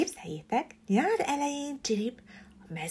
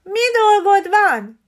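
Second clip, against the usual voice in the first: high, loud, slow parrot call; squawked call-out; calling after; 10 semitones higher, 9 dB louder, slower than usual. A narrator's reading. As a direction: shout after the flying sparrow. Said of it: "kiáltott utána".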